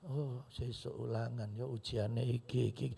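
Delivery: low, slow, soft mimicked fretting; muttered worry. Churchgoer worrying about tomorrow's test, slow low mutter. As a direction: mutter it slowly to yourself.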